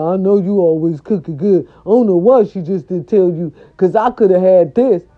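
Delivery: a hint of an aggrieved husband; stepdad voice. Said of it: "He was like".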